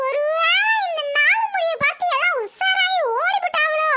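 high, slow comic voice; comic scrounger character. Acted. Neighbourhood scrounger looking for a free meal, high comic voice. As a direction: play a sly food-stealing oddball.

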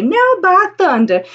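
amazed townsfolk. Acted. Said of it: "they will say".

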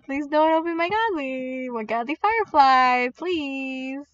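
whiny begging; mock pleading. Player begging for the Godly Firefly, whiny plea. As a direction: beg in a playful, drawn-out whine.

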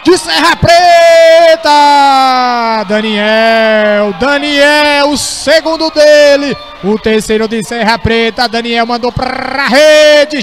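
slow, loud shouted goal call. Real, not acted. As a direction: roar out the goal, stretching it. Goal called in live commentary.

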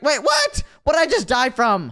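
high startled yell of disbelief; shocked shout. Real, not acted. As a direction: shout in sudden confusion. Dies suddenly in the game.

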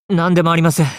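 flustered denial. Acted. Hastily brushes off what he said.

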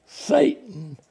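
ordinary talk

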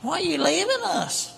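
mock a clingy, pleading partner; whiny Europe. Preacher mocking Europe pleading with America, high whine. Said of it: "Europe jumping up and down going".

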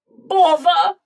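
loud, high storybook outburst of annoyance; cross king. A narrator's reading. King reacts to marmalade offer, crossly. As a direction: exclaim it like a thwarted king.

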